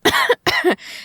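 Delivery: harsh hairspray coughing; coughing fit. A narrator's reading. Daughter choking on hairspray, coughing fit. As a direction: cough hard on the spray. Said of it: "sending me into a coughing fit".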